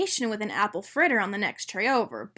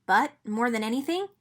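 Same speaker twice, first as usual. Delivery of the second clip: ordinary delivery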